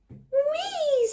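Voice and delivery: high, delighted little-cub squeal; gleeful squeal. Acted. Tiny Honey catching the wind with her pinwheel, high happy squeal. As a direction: squeal with joy at the spinning toy.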